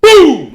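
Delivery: loud firework boom imitation; sudden explosive boom sound; imitated boom. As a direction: shout the blast like a firework going off.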